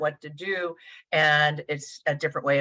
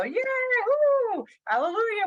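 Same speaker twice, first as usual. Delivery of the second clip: much higher, gleeful cheering; cheering parents; faster than usual. Retold or imitated. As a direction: whoop like a proud parent. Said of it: "they'll jump over and go".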